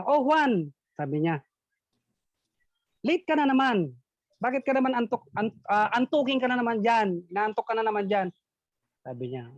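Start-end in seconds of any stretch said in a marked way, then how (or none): 0.0-0.7 a hint of a scolding teacher; calling out Juan like a teacher; mock teacher. Teacher calling the late pupil, lightly scolding. 3.1-8.3 higher, lightly scolding teacher; mock teacher scolding. Teacher scolding sleepy Juan, higher mock nag.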